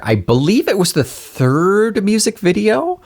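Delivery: silly high voice. Acted. Playful high voice mid-chat.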